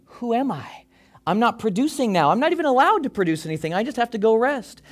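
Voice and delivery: voicing his restless younger self; anxious inner voice. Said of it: "So I'm left wondering".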